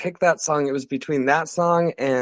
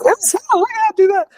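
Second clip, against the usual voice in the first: high and louder, mock-eager yes; eager crew voice; 7 dB louder, much faster than usual. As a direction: play the overexcited crew member jumping at the idea. Crew eager to give ticket.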